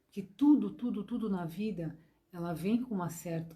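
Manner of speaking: ordinary talk, nothing marked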